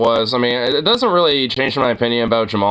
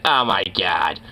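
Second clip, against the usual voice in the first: low mock "smug heckler" voice; mocking, lower-pitched scoff; 6 semitones lower than usual. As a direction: lightly imitate a smug woman in the audience. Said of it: "that just fucking went".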